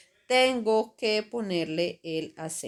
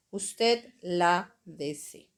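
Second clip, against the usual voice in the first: usted lávese said very slowly, LÁ stressed; teacher drilling usted lávese, very slow; much slower than usual. Shown as a demonstration. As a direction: spell out usted lávese syllable by syllable. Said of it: "con el acento en la A".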